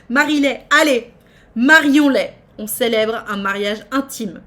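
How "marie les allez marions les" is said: louder, higher, pushing them to marry; loud excited urging; eager loud urging. Real, not acted. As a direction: egg on the wedding loudly. Chat urging wedding.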